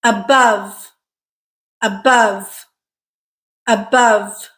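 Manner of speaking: "Above" with stress on the second syllable, bove, falling; careful model "Above", weak a, strong uh in bove; stressed syllable drill. Shown as a demonstration. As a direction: say "Above" alone, a-BOVE, for learners to copy. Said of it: "What syllable is stressed?".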